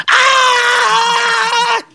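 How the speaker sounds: long high-pitched scream. Acted. Devil flung about by a hammer, screaming. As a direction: let out one sustained, wailing cry.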